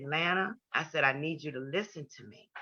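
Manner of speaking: softly retold plea to listen; gentle firmness. Younger sister to weeping Lana, soft and steady.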